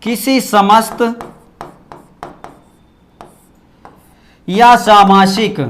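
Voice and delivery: phrase by phrase, long pauses; slow, drawn-out words for note-takers; slow dictation. Shown as a demonstration. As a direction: dictate as you write on the board. Dictating definition while writing it.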